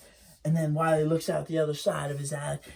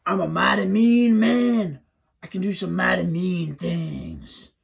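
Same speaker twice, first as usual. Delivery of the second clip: slow, louder menacing boast; hairy man; 3 semitones higher, 5 dB louder, slower than usual. Acted. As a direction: drag it out like a proud brute.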